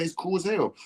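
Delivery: ordinary delivery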